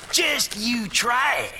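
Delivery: menacing. Acted. Villain threatening the boy.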